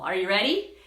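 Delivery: usual speaking voice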